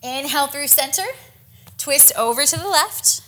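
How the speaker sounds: ordinary talk, nothing marked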